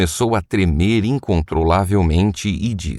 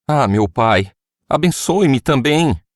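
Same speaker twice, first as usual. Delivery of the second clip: pleading; 7 semitones higher than usual. A narrator's reading. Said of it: "Esaú suplicou".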